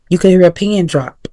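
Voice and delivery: ordinary talk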